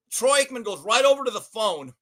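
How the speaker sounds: normal talking voice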